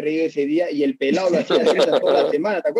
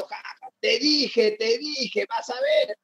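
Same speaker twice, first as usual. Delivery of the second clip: slow, laughing "te dije", ending in a high squeal; gloating laugh; 5 semitones higher, 4 dB quieter, slower than usual. Retold or imitated. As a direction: gloat through a laugh, slowly. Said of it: "Y sale riéndose".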